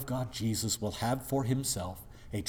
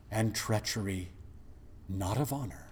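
ordinary talk, nothing marked

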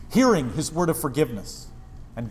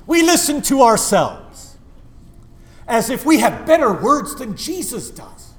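louder, higher fervent preaching; full-force preaching; 9 semitones higher, 8 dB louder than usual. Real, not acted. Pastor scolds congregation at full force, fervent preaching. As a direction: hammer it home from the pulpit.

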